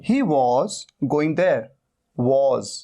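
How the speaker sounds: he was going there, word by word, very slowly; teacher dictating very slowly. Shown as a demonstration. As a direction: spell the sentence out slowly for students. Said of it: "पहली बात तो वा नहीं".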